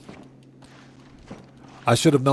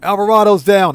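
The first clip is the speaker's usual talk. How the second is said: louder, higher game callout; calling out; 6 semitones higher, 6 dB louder than usual. Real, not acted. Streamer reporting Alvarado killed, calling out. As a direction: call out the kill with a raised voice. Calls out kill during game.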